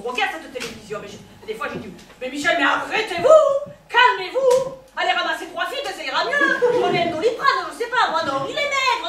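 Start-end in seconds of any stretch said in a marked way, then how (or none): 2.2-9.1 loud, rising comic exasperation; exasperated pleading. Acted. Local woman telling tireless cyclist Michel to stop, exasperated and hammed up. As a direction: beg him to stop, voice climbing in mock alarm.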